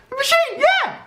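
very high squealed cheer; mock excitement. Hyping the machine to viewers, in high mock excitement. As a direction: squeal it like an over-hyped fan. Hyped repeat of the same words.

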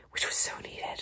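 hushed whisper near the kittens. Real, not acted. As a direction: whisper so the kittens stay calm.

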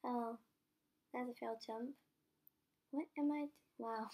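normal talking voice

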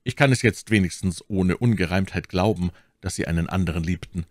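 plain reading voice

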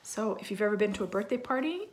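plain reading voice

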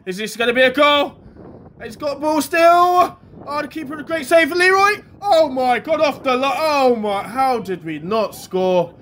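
can't believe the keeper saved it; excited near-miss commentary. Real, not acted. Commentator calling a near goal to viewers, excited yell.

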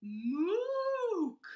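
loud, high, held moose call; playful animal call; mock moose call. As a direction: bellow like a moose.

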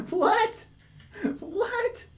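high-pitched baffled shriek of disbelief; shocked squeal. Real, not acted. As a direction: squeak out in total confusion. Baffled by garbled TTS message.

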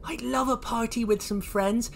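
high-pitched, excited little voice; Oisin. Acted. Oisin dreams of party treats, high and excited. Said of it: "said Oisin".

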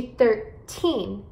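put-on THIRTEEN with a long, stressed TEEN; thir-TEEN, weight on the end of THIRTEEN; stress on final syllable. Shown as a demonstration. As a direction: say THIRTEEN slowly, leaning on the last syllable. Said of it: "você vai enfatizar as sílabas, eh, no final".

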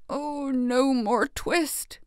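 slow delirious tailor pleading in his sleep; pleading mumble. A narrator's reading. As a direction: beg it in a fever dream. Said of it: "still in his dreams he mumbled".

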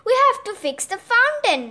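exasperated, punched-out correction; stamping insistence. A narrator's reading. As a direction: insist on the real job. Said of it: "stamped Pat".

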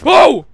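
short, high, loud yelp; startled yell. Real, not acted. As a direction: yelp in sudden fright. Jump scare mid-sentence.

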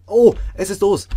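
sudden high, loud cry; startled shout. Real, not acted. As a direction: yelp it out in surprise. Surprised shout as the heart comes loose.

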